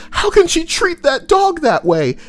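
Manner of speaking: high, prissy gasp of disbelief; squeaky mock outrage over the dog. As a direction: play a shocked dog lover.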